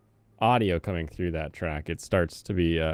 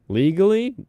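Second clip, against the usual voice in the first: loud emphatic; 10 semitones higher, 9 dB louder than usual. Real, not acted. Sudden emphatic aside.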